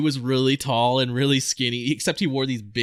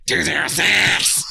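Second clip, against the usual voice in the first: slow, stiff tough-guy count-off; a hint of a fake drill sergeant; imitating recruit; 3 semitones higher, slower than usual. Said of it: "the way he said".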